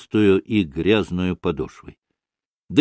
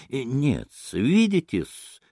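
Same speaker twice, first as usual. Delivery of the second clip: soft, slow, drawn-out wheedling; ingratiating storyteller figure; 3 semitones higher, 4 dB quieter, slower than usual. Acted. Maksimov turns to explain himself to Mitya, slow and ingratiating. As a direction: stretch it out obligingly.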